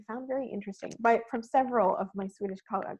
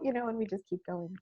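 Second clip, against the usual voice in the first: ordinary talk, nothing marked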